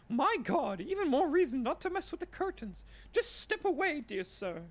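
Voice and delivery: a jittery shop lady begging you to stop; panicked shopkeeper. Acted. Shopkeeper shaken, pleading.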